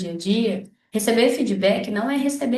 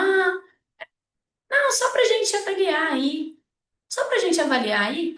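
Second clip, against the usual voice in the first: higher-pitched, airy non-answer; superficial brush-off; 7 semitones higher than usual. Acted. A vet dodging a client's question about the exam, superficial brush-off. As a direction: toss off a vague, evasive reply. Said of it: "como é que essa resposta é fútil".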